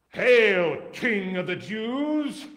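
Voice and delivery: loud, slow, sneering hail; mocking salute. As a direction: hail him like a jeering soldier. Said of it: "after mocking him".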